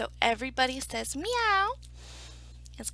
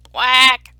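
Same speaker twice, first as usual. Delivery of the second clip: duck's quack; 19 dB louder than usual. Acted. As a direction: blurt out a big honking quack. Duck interrupts the chorus.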